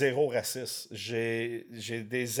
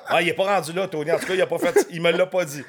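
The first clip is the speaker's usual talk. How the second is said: laughing; 5 semitones higher, 8 dB louder, faster than usual. Real, not acted. Amused reply while still laughing.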